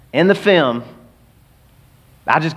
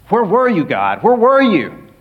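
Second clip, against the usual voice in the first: higher, louder pleading call; anguished cry; 6 semitones higher, 3 dB louder, slower than usual. Acted. Believer crying out to God in an anguished prayer.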